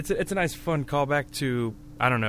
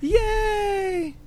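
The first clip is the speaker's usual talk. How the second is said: high squeal of joy, imitated; girlish cheer. Friend voicing a happy girl in the anime, high squeal.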